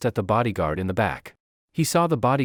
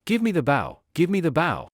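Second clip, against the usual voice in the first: urgent call; 6 semitones higher than usual. Acted. Hero shouts for the bow.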